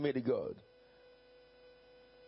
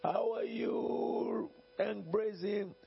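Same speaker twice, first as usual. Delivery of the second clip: high, slow, drawn-out friendly call; cheerful greeting; 10 semitones higher, much slower than usual. Acted. Pastor calls out to a former member, high and drawn out. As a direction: greet him across the road, much higher.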